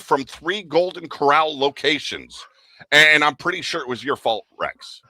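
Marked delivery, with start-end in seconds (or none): none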